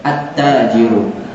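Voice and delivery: slow, leaning on the doubled t of At-tajiru. Shown as a demonstration. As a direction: stretch the shadda in At-tajiru. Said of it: "disyadahkan musyaddada". (tt pada At-tajiru ditekan pelan)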